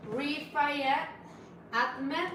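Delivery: Rifayet Ahmed, said slowly and rising like a question; careful name try. Shown as a demonstration. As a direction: pronounce "Rifayet Ahmed" as a hesitant attempt. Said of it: "you have to give it a try too".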